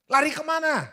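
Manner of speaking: high, loud question to God; acted desperate shout. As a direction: yell it back as a bewildered Moses. Acted Moses shouting back to God.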